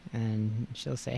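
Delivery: normal talking voice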